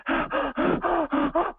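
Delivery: sharp panicked gasp for air. Retold or imitated. Daughter on the phone, imitated panicked gasp.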